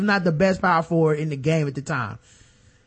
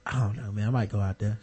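coy low mumble; 11 semitones lower, 9 dB quieter than usual. Retold or imitated. Guy's coy reply voiced.

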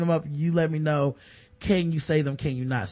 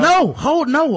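higher, louder sharp command; mock yell; 8 semitones higher, 6 dB louder, slower than usual. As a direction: bark it to hold him down. Mock Ray Lewis pins him.

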